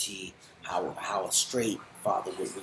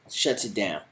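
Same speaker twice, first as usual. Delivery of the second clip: normal talking voice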